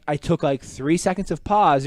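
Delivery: usual speaking voice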